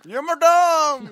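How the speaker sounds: high, drawn-out holler through food; very loud, high-pitched mouthful yell; mouthful shout. Pretend. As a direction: bellow it slowly with a mouth full of candy. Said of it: "Say it with a mouthful of Twix".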